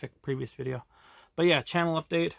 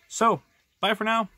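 bright, higher, louder goodbye; cheery sign-off; 8 semitones higher, 8 dB louder than usual. Real, not acted. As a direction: sing out the goodbye.

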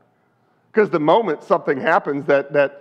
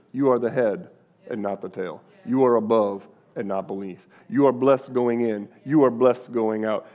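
low, soft proclamation; spoken blessing; 6 semitones lower, 4 dB quieter than usual. Acted. Preacher blessing the congregation, low and soft. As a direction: speak the blessing over them.

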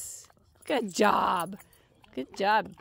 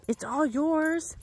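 ordinary talk

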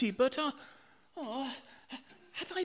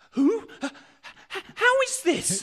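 high, loud, alarmed question; startled cry; 8 semitones higher, 9 dB louder than usual. Acted. Old man startled by someone entering, crying out high and loud. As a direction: jump at the sight.

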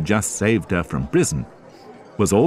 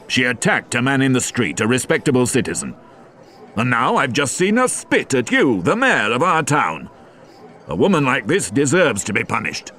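a hint of an indignant policeman, a bit higher; lightly voiced stern inspector; 5 semitones higher than usual. Javert objecting to the mayor, lightly voiced and indignant. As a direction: argue with mild indignation.

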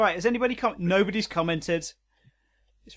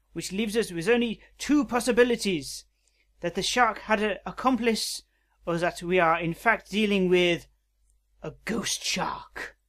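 put-on French accent. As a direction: lay out the deduction in mock French. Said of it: "Robo Kitty has a French accent".

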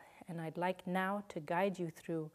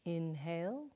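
guided breathing cues. Shown as a demonstration. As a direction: lead the breath gently, leaving room to breathe. Counts breaths for the class.

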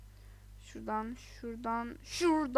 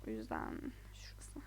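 low breathy whisper; 13 semitones lower, 15 dB quieter than usual. Real, not acted. Picking the glass, a low whisper. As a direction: say it almost silently.

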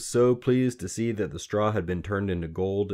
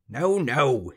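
raised, louder double no; cross refusal; 7 semitones higher, 6 dB louder than usual. A narrator's reading. Little man stamping at a wrong guess, cross. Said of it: "he stamped his foot".

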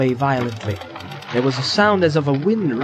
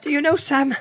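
Frodo voice; 11 semitones higher than usual. A narrator's reading. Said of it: "he said at length".

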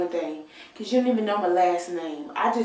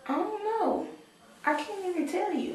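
much higher squeaky "I don't know"; high-pitched puzzlement; 12 semitones higher than usual. Real, not acted. Stumped on her favorite movie, a much higher squeak.

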